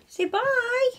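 high doggy voice for waving bye to viewers; talking to the dog. Real, not acted. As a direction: coo goodbye like talking to a puppy. Baby talk to pet dog.

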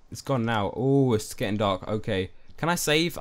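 ordinary delivery

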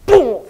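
sudden high loud boom; imitated boom. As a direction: blurt out the hammer's boom. Hammer sound imitated.

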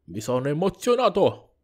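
livelier reading, swelling on emozionato; hammed-up emotion. Game character repeating being moved, hammed-up and excited. Repeats line with more feeling.

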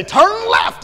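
high, loud robotic turn call; loud GPS voice. Retold or imitated. Imitating the car's GPS blaring at them. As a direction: bark it like a blaring navigation voice. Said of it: "and it's real loud".

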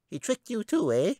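very high chipmunk squeak, knowing; tiny rodent sizing her up; squeaky chipmunk. A narrator's reading. Chipmunk character voice.

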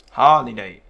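firm HO-li-day demo; stress on first syllable. Shown as a demonstration. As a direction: hit the opening of Holiday. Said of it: "vào âm tiết thứ nhất".

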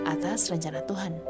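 ordinary talk, nothing marked

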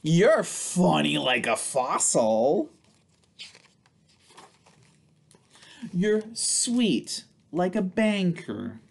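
slow, playful picture-book read; reading to children. Acted. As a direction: read it aloud to little ones with big expression. Reads picture book to kids.

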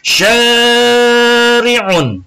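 "Syari'un" drawn out high and long for students to copy; model pronunciation. Shown as a demonstration. Teacher models "Syari'un" for the class to repeat. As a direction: sound out "Syari'un" slowly, high and clear. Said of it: "Sekali lagi".

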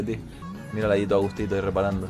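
normal talking voice

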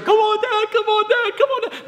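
put-on very high-pitched child cheer; kids cheering. Kids cheering their dad on the trampoline, excited. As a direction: pipe up like excited kids egging on their father. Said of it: "they're all cheering".

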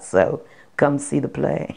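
usual speaking voice

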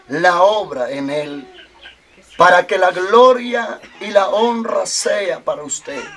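slow, rising preacher's prayer with a cry in it; fervent prayer. Acted. Pastor interceding aloud for Carmelo's healing, fervent prayer. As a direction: plead with God for the man in front of you.

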